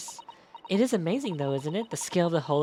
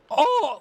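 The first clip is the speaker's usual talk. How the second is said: cracking yelp; 11 semitones higher, 8 dB louder than usual. Retold or imitated. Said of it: "then he gets that crack".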